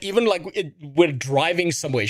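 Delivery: usual speaking voice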